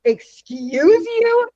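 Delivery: high, slow, outraged "Excuse you?"; indignant excuse you. Retold or imitated. A woman stunned by the old man's words, drawn out high and outraged. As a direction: draw it out high in shocked outrage. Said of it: "she, like".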